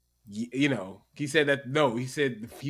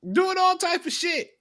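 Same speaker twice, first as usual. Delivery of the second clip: "Doing all type of shit" repeated much higher for emphasis; emphatic repeat; 12 semitones higher than usual. Shown as a demonstration. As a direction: hit it again up high, exasperated.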